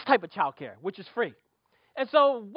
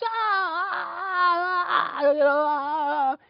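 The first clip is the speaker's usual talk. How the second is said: imitated sobbing wails, higher; mock crying; 9 semitones higher, much slower than usual. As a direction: wail like a crying baby, hammed-up. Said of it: "God said to me".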